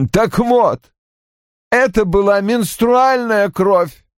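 high-pitched, icily emphatic; slow, stiff correction; cold explanation. A narrator's reading. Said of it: "холодно пояснил Рой".

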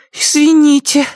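put-on squeaky child's sorry; apologetic little boy. A narrator's reading. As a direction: apologise like a scared small boy.

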